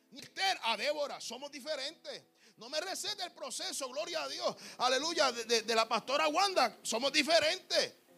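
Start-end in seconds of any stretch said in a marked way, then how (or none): none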